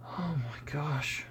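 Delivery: breathless whisper, slow. Real, not acted. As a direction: whisper it, spent from laughing. After laughing fit.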